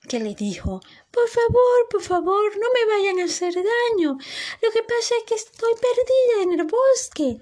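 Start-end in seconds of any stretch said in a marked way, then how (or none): 1.1-7.4 súplica aguda y temerosa; asustada. Acted. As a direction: ruega que no le hagan daño. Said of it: "se asustó tanto". (Sofía suplica a los enanitos, voz aguda y asustada)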